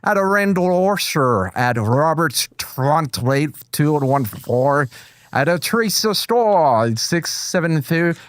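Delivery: slow, put-on Scottish accent; badly faked Scottish accent; attempted Scottish accent. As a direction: read names in a laboured fake Scottish. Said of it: "so Scottish accent?".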